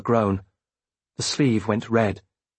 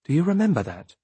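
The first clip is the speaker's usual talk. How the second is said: plain reading voice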